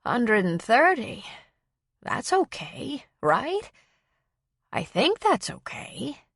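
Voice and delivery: lightly voiced uncertain elderly woman; unsure old woman. A narrator's reading. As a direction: ask as if hoping to be told yes. Unsure old woman reading result.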